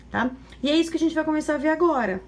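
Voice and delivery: ordinary delivery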